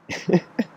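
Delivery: short real chuckle; high little laugh escaping mid-story. Real, not acted. Speaker recalls a tragic moment to listeners, laughing. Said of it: "I'm laughing because".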